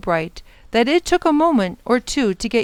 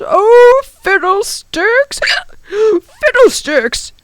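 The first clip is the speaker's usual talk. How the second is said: loud, high, drawn-out exasperated outburst; grumbling professor; 14 semitones higher, 8 dB louder, much slower than usual. A narrator's reading. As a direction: exclaim slowly like a fussy professor. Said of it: "the professor grumbled".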